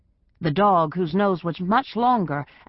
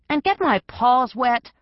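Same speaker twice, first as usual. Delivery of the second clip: higher, louder, an outraged prissy cat; voice rising indignantly; 7 semitones higher, 4 dB louder than usual. Acted. Cat refuses to cross the creek, rising in indignation. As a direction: squeal it like a cat who hates water. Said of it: "Pewter's voice rose".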